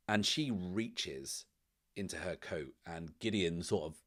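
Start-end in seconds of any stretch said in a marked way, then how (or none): none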